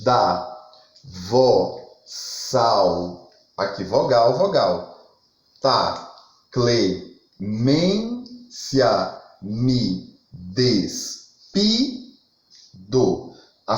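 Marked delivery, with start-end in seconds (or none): 0.0-3.3 one low syllable at a time; separate, measured beats; syllable-by-syllable scansion. Shown as a demonstration. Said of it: "o segundo verso". 5.6-12.0 low, slow, syllables far apart; each syllable held, then a pause; syllable-by-syllable scansion. Shown as a demonstration. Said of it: "o segundo verso".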